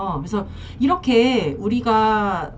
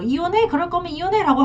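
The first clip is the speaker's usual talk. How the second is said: higher, half-hearted mock yell of the wife; mock shouting; 8 semitones higher, faster than usual. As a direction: imitate her scoffing '이혼해' without real volume. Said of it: "큰 소리 치면서". (아내가 큰소리치듯 흉내 낸 가벼운 호통)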